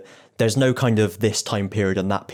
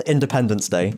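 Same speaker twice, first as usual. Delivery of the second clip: ordinary delivery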